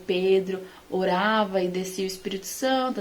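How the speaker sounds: ordinary delivery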